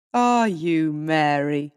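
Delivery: a mocking laugh in the question. A narrator's reading. Husband laughing at his wife, mocking chuckle. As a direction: say it with a sneering chuckle. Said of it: "he laughed".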